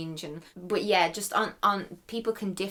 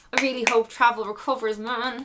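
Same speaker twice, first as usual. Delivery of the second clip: fervent wish; 5 semitones higher, 7 dB louder than usual. Real, not acted. Heartfelt wish.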